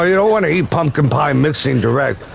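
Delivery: normal talking voice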